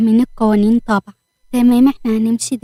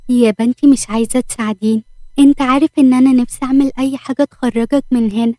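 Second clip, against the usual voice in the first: usual speaking voice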